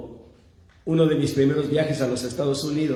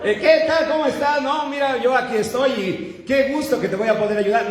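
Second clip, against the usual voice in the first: cheerful Central American helper; 8 semitones higher than usual. Retold or imitated. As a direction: greet him like a chatty helper showing off. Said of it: "como que trae ya el chévere".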